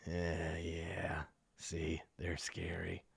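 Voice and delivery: low, hushed, slow menace; grinning dog owner. Acted. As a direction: murmur it low and drawn out. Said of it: "he's kinda just like".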